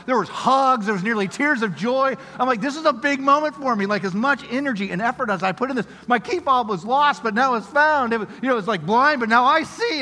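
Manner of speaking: high, loud, gushing retelling; hammed-up overjoyed testimony; elated celebration. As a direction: build it into a mock-epic moment of joy. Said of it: "this elation of celebration".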